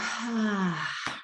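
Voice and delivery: a soft, quiet "ah" sigh breathed out; soft exhale sigh. Shown as a demonstration. Teacher sighing along with the group, soft "ah" exhale. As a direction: let the breath fall out on an ah. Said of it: "And as you exhale".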